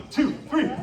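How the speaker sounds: normal talking voice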